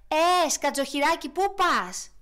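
tortoise shouting after the hedgehog; calling out. Acted. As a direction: hail the hedgehog from afar. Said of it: "του φώναξε μια χελώνα".

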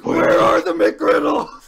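loud hoarse yell, drawn out; acted shout. Character demanding McGriddles at the counter, shouting. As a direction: bellow the question slowly.

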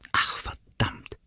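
hushed frustrated curse under breath; muttered curse. Acted. Ronnie spotting the raccoon, a muttered curse. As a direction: swear softly to herself.

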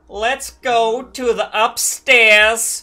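loud, high, drawn-out impression of a comedian; exaggerated celebrity parody, slow and booming; Bill Cosby impression. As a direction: stretch each word out high and loud. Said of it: "Bill Cosby impression".

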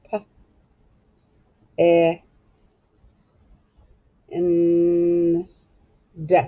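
"P-, E-, N-, D." sound by sound, with long gaps. Shown as a demonstration. Teacher spelling out pend for pupils, "P-, E-, N-, D." sounded out one by one. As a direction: stretch "N-" and separate each sound of "P-, E-, N-, D.".

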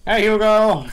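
loud high shout across distance; calling out. Real, not acted. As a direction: yell for your teammate. Calling teammate across the map.

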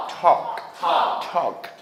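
"talk" drilled as open taw-k; open-mouthed vowel. Shown as a demonstration. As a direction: drop the jaw on "talk". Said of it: "입을 좀 벌려서".

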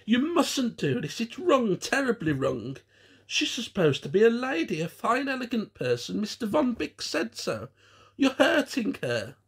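high, upset protest; almost a shout. A narrator's reading. Gwen objects to the puppet's treatment, almost shouting. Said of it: "in what was almost a shout".

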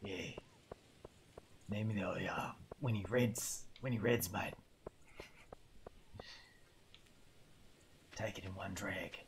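raspy smoker's croak, low and rough; smoker's cough voice. Retold or imitated. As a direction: rasp it out like a lifelong smoker. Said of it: "Super Smoker's cough voice".

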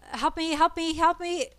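panicked plea; pleading for help. Real, not acted. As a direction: beg urgently. Begging friend during boss fight.

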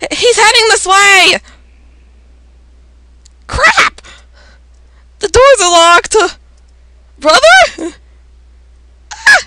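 high, urgent cries; panicked alarm. Acted. Friends fleeing find the doors locked, crying out in panic. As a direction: call out in high-pitched panic.

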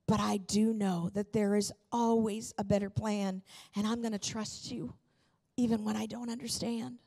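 ordinary delivery

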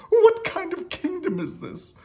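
high, loud royal whining; hammed-up high-pitched kingly complaint; complaining king. A narrator's reading. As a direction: wail it like an exasperated cartoon king. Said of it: "complained the king".

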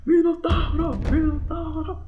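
high shouted cheer; crowd cheer. Acted. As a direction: chant his name from the stands. Plateia torcendo pelo Minotauro. (torcida gritando o nome)

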